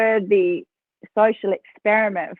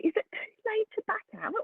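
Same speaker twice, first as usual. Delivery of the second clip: high, small, quick whispery voice; a tiny nervous voice in her head, much higher and softer; timid little voice in her head asking to back out; little inner voice; 12 semitones higher, 11 dB quieter, faster than usual. Retold or imitated. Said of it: "I hear this little voice going".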